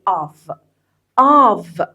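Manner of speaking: "Of" said as one syllable, "av"; native-style reduced "I've", said "av"; contracted pronunciation. Shown as a demonstration. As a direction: model "Of" as a short vowel running into v. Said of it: "أقولها كأنك بتقول A و V".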